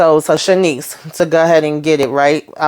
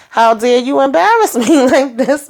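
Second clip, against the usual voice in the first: higher-pitched mock outrage; retold indignation; 6 semitones higher than usual. Alex complaining to Van from the bathroom, mock indignant.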